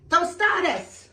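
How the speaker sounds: high, slow, proud reveal; excited announcement. Real, not acted. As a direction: present the dish with flair. Presenting the finished dish.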